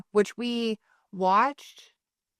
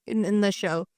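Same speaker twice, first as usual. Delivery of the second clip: usual speaking voice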